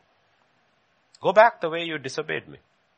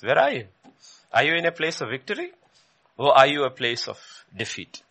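ordinary talk

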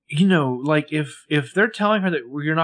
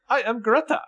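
high, bright-eyed hello; squeaky new assistant; 12 semitones higher than usual. Retold or imitated. New assistant greeting Hannah, pitched up high. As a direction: chirp it like an eager newbie. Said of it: "new assistant's like".

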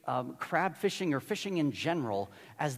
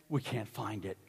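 sheepish crew; 8 semitones lower, 8 dB quieter than usual. Retold or imitated. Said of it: "and they're like".

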